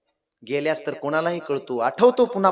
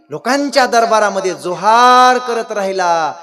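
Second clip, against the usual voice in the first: raised voice swelling with indignation for his father; louder, higher outburst of filial feeling; impassioned son; 4 semitones higher, 7 dB louder than usual. Acted. Son's impassioned tribute to father.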